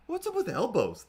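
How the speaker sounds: high, goofy put-on voice; playful bit about elbows; silly voice. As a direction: squeak it out in mock puzzlement. Comic aside in odd voice.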